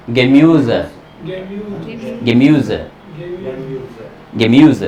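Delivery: गेम्यूज with a half e and rounded ü; German umlaut pronunciation. Shown as a demonstration. Teacher repeating गेम्यूज for the class. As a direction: say गेम्यूज as gemüs, short e, umlaut ü. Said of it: "आधा ए।".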